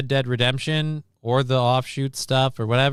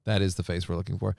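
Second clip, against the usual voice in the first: normal talking voice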